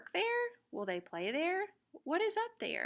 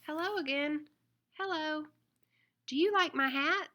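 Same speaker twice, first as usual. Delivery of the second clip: higher, lively little lady dog asking about her hat; perky, sing-song girl dog, a touch faster at the end; 5 semitones higher, 5 dB louder, faster than usual. Acted.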